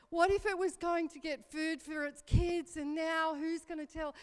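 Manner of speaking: high, loud distressed pleading; spiralling worry, voice climbing; frantic plea. Retold or imitated. Mother frets to son about the bird's kids, high and loud.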